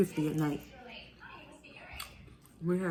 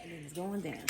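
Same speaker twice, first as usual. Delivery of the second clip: much softer, tossed-off repeat; quiet echo of the chant; 2 semitones lower, 11 dB quieter than usual. Real, not acted. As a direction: let it fall away to a near murmur.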